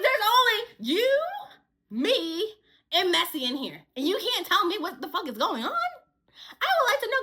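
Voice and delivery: loud, high retold demand; indignant confrontation. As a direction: demand an answer, punching each person named.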